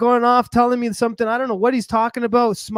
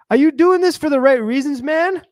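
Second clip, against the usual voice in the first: raised, louder probing question; acted challenge; 6 semitones higher, 4 dB louder than usual. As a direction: confront a friend, voice lifted. Voices the priests challenging him.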